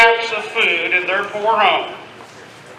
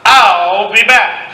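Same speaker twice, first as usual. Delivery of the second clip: villain threat; 2 semitones lower, slower than usual. Acted.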